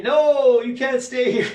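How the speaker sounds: high, loud, drawn-out refusal; insistent angels. Retold or imitated. Angels sending Mama back up, high loud insistence. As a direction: cry it out high to turn her away. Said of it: "the angels have to come in and say".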